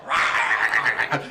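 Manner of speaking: loud, sustained growly yell; hammed-up outburst; mock roar. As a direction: burst out with an imitated roar. Imitates an actor's outburst.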